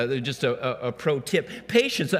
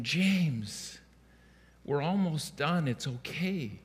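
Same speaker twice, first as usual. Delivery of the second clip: gentle soothing; 7 dB quieter, slower than usual. Retold or imitated. Father soothing crying toddler again.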